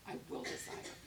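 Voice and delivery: ordinary delivery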